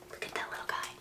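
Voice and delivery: breathy whisper. Real, not acted. Showing the calf to a child, a soft whisper. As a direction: whisper it tenderly.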